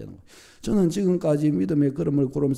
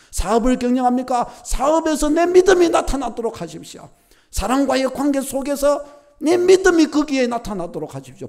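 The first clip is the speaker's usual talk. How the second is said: louder, higher fervent preaching; full-force preaching; 6 semitones higher, 5 dB louder than usual. Acted. Pastor urging the church to show faith, fervent preaching. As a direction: drive the exhortation home to the congregation.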